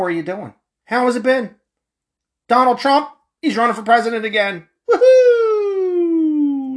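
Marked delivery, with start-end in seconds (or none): 4.9-6.8 high mock woohoo; sarcastic celebratory whoop; sarcastic cheer. Cheering Trump's run, sarcastically.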